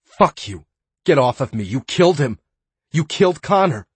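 high, loud rising scream of accusation; furious yell. Acted. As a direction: yell it at him, building to the name. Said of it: "she yelled".

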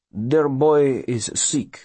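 put-on slow, careful foreign-accented voice; earnest immigrant passenger pleading a family's case; foreign colonist with broken English. A narrator's reading.

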